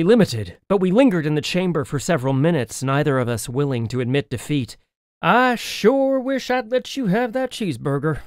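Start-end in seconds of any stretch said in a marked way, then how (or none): 5.2-8.2 grumbling. Acted. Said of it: "she grumbled".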